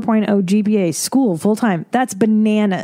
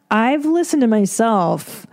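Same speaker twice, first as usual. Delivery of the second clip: ordinary talk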